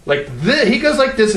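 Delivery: normal talking voice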